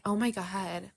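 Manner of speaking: mock awestruck whisper. Fans reacting to ContraPoints, awestruck whisper. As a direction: whisper it like a stunning revelation. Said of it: "saying the same thing and say".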